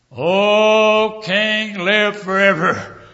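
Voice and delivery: high, drawn-out reply from afar; calling out. Acted. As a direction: sing it out loudly to the king. Daniel calling back from den.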